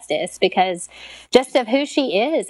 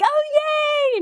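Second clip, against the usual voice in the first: very high-pitched little cheer; imitated cheering. Imitating the mothers hearing there is a name, high cheering. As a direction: squeal it like delighted relatives cheering. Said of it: "they're kinda cheering".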